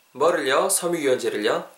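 ordinary delivery

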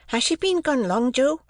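put-on small boy asking anxiously; young Pip. A narrator's reading.

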